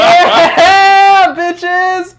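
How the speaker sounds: loud, very high victory yell; triumphant cheer. Acted. Teller celebrates the girl's yes in his mind, yelling triumphantly. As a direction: drag out a yell of pure triumph.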